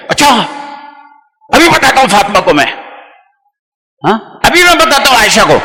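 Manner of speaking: quick, a touch heated reply; mild mock outburst at his wife; worked-up son. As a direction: rush it like a son getting riled up. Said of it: "اب ایک دم جذباتی ہو گیا".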